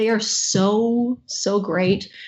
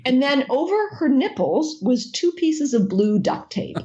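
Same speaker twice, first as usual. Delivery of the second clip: usual speaking voice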